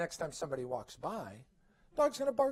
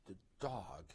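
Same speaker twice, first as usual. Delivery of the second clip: ordinary talk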